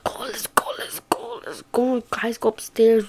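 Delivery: hushed, hurried whisper; urgent unvoiced whisper; whispering urgently. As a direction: whisper so dad won't hear. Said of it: "We were like".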